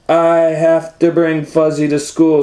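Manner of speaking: put-on high, loud little boy plea; insistent kid voice; pleading child. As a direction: wail it like a desperate kid. Owen pleading loudly.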